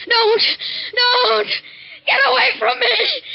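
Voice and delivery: panicked shouting in her sleep; screaming in a nightmare. Acted. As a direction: beg the dream figure to stay away. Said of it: "Why were you screaming?".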